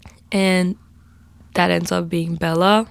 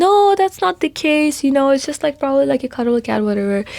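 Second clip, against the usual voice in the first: mock boyfriend; 7 semitones higher than usual. Quoting boyfriend dismissing worry.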